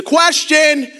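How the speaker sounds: loud, sharp shout of a heckler; called-out interruption. Retold or imitated. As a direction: blurt it from the back row. Classmate calls out mid-presentation.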